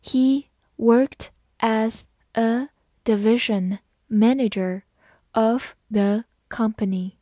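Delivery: slow, word by word; careful teacher's model for repeating; slow read-through. Shown as a demonstration. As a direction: read slowly, leaving a gap after every word. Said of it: "好，慢读一遍".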